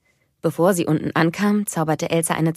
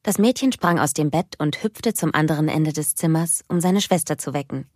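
ordinary delivery, nothing marked

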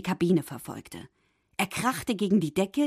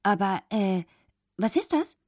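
puzzled. A narrator's reading. Fish voice asks about fork.

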